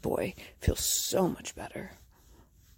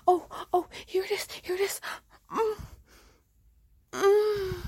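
higher and louder, building to peak moans; rising excitement; 9 semitones higher, 3 dB louder than usual. Acted. To her partner at the climax, rising excited cries. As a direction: let it climb into cries.

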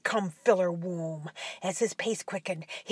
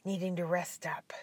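whispering; 3 semitones lower, 10 dB quieter than usual. A narrator's reading. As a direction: breathe the ending quietly. Whispered closing narration.

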